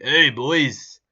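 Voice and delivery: loud mock Geordie accent. Mocking the podcaster copying the singer, loud Geordie impression. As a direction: call it out in an exaggerated Geordie accent. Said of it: "[name]'s Geordie accent".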